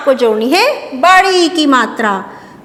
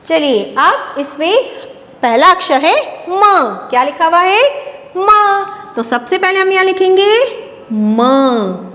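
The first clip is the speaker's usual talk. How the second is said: normal talking voice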